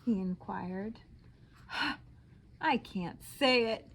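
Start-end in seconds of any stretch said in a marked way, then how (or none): none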